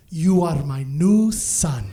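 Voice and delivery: low, very slow mom impression; imitated mom. Mom hugging her new dog, low slow solemn voice. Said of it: "heard her say in Arabic".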